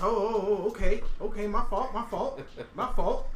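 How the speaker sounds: imitated high, loud flustered backing off; lightly acted yelping apology. Acted reaction to a crushing handshake.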